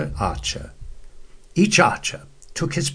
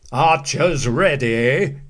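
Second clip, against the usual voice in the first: slow, drawn-out command; slow commanding call; captain's call; 3 dB louder, slower than usual. A narrator's reading. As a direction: call the archers to the line. Captain calls the archers.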